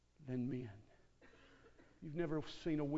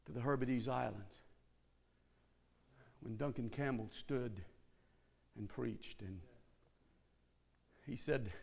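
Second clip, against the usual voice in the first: usual speaking voice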